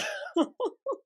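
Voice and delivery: very high rising giggle. Real, not acted. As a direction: squeal a quick laugh. Laughing after begging.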